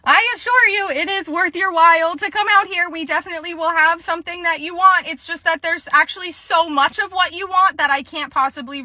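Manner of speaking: high-pitched customer-service voice; placating employee. Acted. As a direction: reassure a difficult caller in a high voice.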